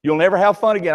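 normal talking voice

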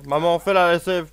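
loud, bossy command to mum; bossy loud order. Retold or imitated. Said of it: "Genre, je fais".

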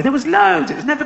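mock carefree squeal; gleeful high burst. Voicing lazy mouse's carefree view.

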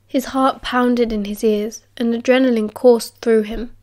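usual speaking voice

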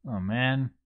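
ordinary delivery

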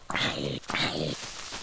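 soft unvoiced gasp; a hushed, frightened intake of breath; whispered gasp. Real, not acted. Gamer spotting a creeper, quiet frightened gasp.

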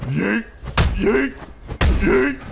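loud, high bursts; fighting shouts. Real, not acted. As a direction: shout with each punch. Throwing punches in the game.

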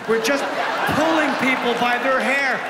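ordinary delivery

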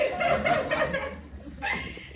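bright high laugh; giggling at the crowd. Real, not acted. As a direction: laugh out at your own joke. Speaker laughs at own joke.

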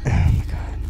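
frightened whisper. Real, not acted. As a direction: breathe it out scared. Scared hushed exclamation.

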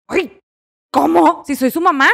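indignant mother. Retold or imitated. Mother offended at her son's answer, mildly indignant. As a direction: huff like a mother who feels disrespected. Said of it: "me enojé y dije".

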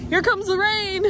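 excited. Real, not acted. Rain arrives, calling out.

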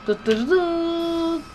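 high beeps with a long held last note; drawn-out high "dıt"; mimicked beeping. Real, not acted. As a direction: beep along, stretching the final note high. Mimics a game jingle or beep.

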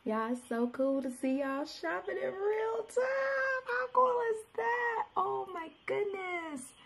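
high, soft voice close to crying; choked up with gratitude; happy tears. Real, not acted. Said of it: "trying not to cry".

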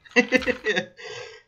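high burst of laughter; loud laugh. Real, not acted. Laughing at friend's loss.

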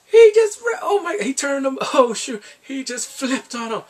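high, loud, sputtering disbelief; shrieky, stumbling outrage at a betrayal; aghast exclamation. Real, not acted. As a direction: squeal it, breaking off as if scandalized.

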